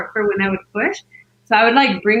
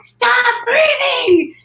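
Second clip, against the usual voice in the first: high, drawn-out exasperated shout; shrill-pitched, slow yell of annoyance; retold yell; 14 semitones higher, 3 dB louder, much slower than usual. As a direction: imitate yourself snapping at someone, each word stretched. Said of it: "I yelled at everyone".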